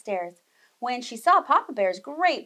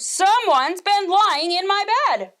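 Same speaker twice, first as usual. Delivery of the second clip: Papa Bear; 8 semitones higher, 8 dB louder, slower than usual. A narrator's reading. Papa Bear discovering his bed.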